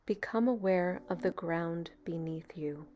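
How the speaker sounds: calm, even meditation voice with long pauses; guided meditation. Real, not acted. Meditation leader easing a beginner into relaxation, calm and unhurried. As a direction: guide them gently into stillness, leaving space between phrases.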